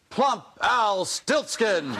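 drawn-out, high, booming introduction; slow game-show host announcement; announcer shout. Acted. Announcing Al with flourish.

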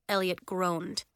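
plain reading voice

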